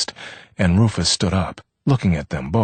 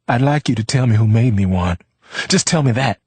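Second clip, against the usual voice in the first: high, louder defiant demand; flaring up; 6 semitones higher, 5 dB louder than usual. A narrator's reading. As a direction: flare up at him. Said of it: "she flared".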